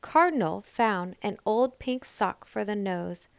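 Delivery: ordinary delivery, nothing marked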